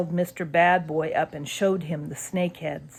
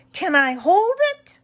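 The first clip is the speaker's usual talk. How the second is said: eager; 13 semitones higher, 5 dB louder than usual. A narrator's reading. Ethan eager.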